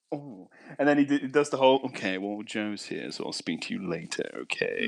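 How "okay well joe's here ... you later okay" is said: low, soft mock-posh phone murmur; posh flirting voice. Friend mimics Jack ending the call to his missus, posh flirting voice. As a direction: imitate a smooth fuckboy signing off tenderly. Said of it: "like, proper posh little fuckboy".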